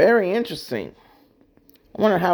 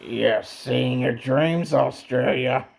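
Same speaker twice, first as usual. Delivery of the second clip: Freddy; 3 semitones lower than usual. Acted. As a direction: draw it out like a gloating dream demon. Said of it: "Freddy, that's not nice".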